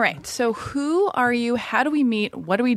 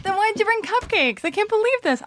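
much higher, playful disbelief; mock outrage; 12 semitones higher than usual. Real, not acted. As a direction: tease him in high-pitched shock. Shocked he is married.

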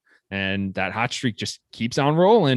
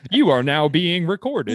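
slow, higher automated announcement; Zoom robot voice; 7 semitones higher, 3 dB louder, slower than usual. Retold or imitated. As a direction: read it like a recorded system notice. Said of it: "You sound like the Zoom robot".

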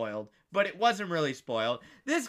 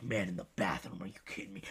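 low, soft grumble trailing to a near whisper; mumbling to himself; 11 semitones lower, 8 dB quieter, faster than usual. Retold or imitated. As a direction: grumble under your breath, imitating the aggrieved man. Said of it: "He's just mumbling to himself".

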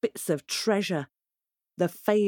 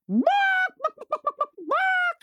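chicken squawk. A narrator's reading. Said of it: "she said".

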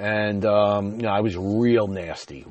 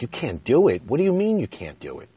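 higher-pitched, indignant disbelief; incredulous retort; 4 semitones higher, 3 dB louder than usual. Retold or imitated. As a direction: throw it back in disbelief, retelling a spat. Acted quarrel, throwing her words back.

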